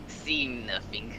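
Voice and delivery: ordinary talk, nothing marked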